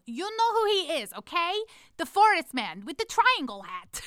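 high, loud exasperated outburst; frustrated kid yell. Retold or imitated. Fed-up camp kid explaining the pendant figure, frustrated yell. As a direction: yell it like a fed-up child, drawing out the last words. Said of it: "finally got frustrated and yelled".

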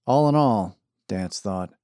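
ordinary delivery, nothing marked

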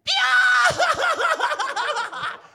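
shrieking, very high cackle; witch laugh. Retold or imitated. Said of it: "I just witch laughed".

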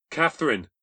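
high, loud call across the street. A narrator's reading. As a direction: shout her name through the rain. Running to her in rain.

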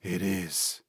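soft, throaty voice from the dark. A narrator's reading. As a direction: speak softly from the throat. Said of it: "came a throaty voice".

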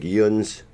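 "yuns" said as one syllable, like "yunz"; Baltimore dialect word. Retold or imitated. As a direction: say "yuns" as local slang for you. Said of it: "they say things like".

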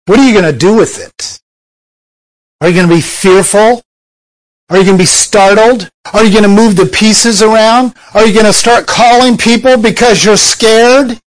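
fast, rising challenge; quick, climbing string of challenges; preaching at full force. Real, not acted. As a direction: fire question after question at them.

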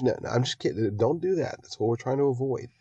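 ordinary talk

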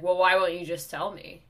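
loud, demanding customer; mock exasperated demand. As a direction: imitate an impatient man raising his voice. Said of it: "his voice is getting louder and louder".

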